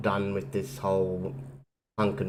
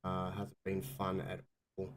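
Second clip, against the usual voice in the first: tired, quiet voice from covid; sick voice; 2 semitones lower, 7 dB quieter than usual. Real, not acted. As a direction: speak quietly through illness. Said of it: "dealing with covid".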